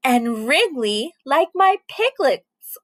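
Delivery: usual speaking voice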